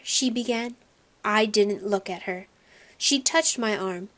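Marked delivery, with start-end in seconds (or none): none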